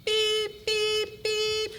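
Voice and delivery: high mock beeping; phone beeps. Narrator mimicking a text alert, high beeps. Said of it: "cell phone alerts him".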